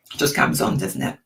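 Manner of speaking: croaky, failing voice; hoarse voice. Real, not acted. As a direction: voice dropping low and breaking to a rasp. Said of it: "My voice has gone a bit quavery there".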